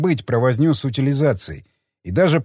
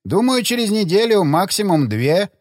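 academic voice; 5 semitones higher than usual. Acted. Academic answers chairman.

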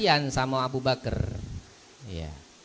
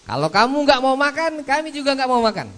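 a bit higher and faster, defiant; mildly stubborn guest; 12 semitones higher, 6 dB louder than usual. Retold or imitated. Guest refusing to eat until the host eats, mildly defiant. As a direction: dig in your heels as the guest. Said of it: "tamu yang lain juga mengatakan".

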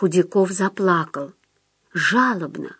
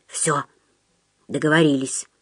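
low, quiet verdict; decisive ruling; 5 semitones lower, 4 dB quieter than usual. A narrator's reading. Остап closes the deal with Худяков, low decisive tone. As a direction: settle the matter in a lowered voice. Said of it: "постановил Остап".

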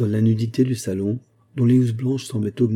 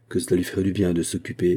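ordinary delivery, nothing marked

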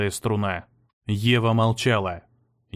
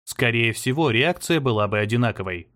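plain reading voice

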